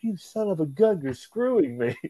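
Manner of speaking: high, playful indignation; half-joking cry of betrayal; mock outrage. Wrestler teasing JBL for tricking him, playfully outraged.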